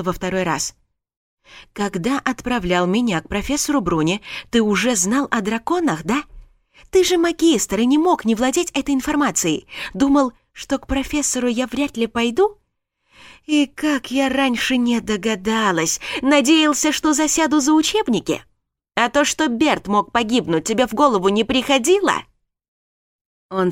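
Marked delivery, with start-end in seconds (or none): 1.7-16.0 angry. Acted. Alexia accuses Darren. 16.2-16.9 much higher, scornful Надеялся; mocking echo. Alexia mocks his word. 16.9-22.1 angry. Acted. Alexia accuses Darren.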